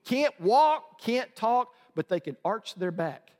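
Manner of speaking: normal talking voice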